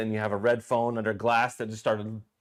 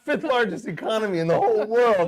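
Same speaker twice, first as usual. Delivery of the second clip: higher, eager mock interview question; excited fan; 8 semitones higher, 4 dB louder than usual. Hosts imagining meeting Arnold, gushing. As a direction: gush like a starstruck fan.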